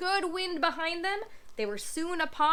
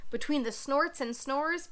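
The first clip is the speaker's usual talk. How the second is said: ordinary delivery, nothing marked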